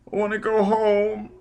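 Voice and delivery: put-on high, drawn child's whine; whimpering child. A narrator's reading. Hurt boy begging Foyle to go home, whimpering high. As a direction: plead in a small boy's voice. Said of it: "Sigurd whimpered".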